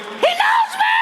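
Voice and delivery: extremely high falsetto shriek of joy; ecstatic inner scream. Retold or imitated. Starstruck comedian screaming inside his head, ecstatic shriek. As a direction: squeal it at the very top of the voice. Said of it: "And in my head".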